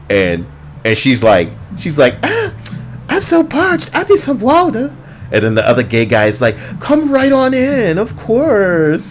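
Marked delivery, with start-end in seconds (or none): 2.2-4.9 very high, breathy-free falsetto parody of a parched stranger; mock witch voice. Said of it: "he doesn't sound like that". 6.8-9.1 high, slow welcoming parody. Retold or imitated. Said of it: "He's, he doesn't sound like that".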